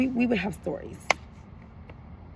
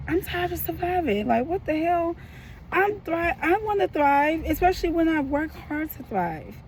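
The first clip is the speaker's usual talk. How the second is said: higher, strained voice, fed up; frustrated outburst; 6 semitones higher than usual. Real, not acted. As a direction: let the frustration spill out, pitched up. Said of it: "I'm frustrated".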